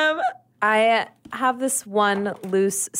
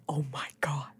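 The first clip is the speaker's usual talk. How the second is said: breathless whisper at the mirror; hushed, amazed oh my God; awed whisper; 7 semitones lower, 11 dB quieter than usual. Acted. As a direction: whisper it, stunned by your reflection.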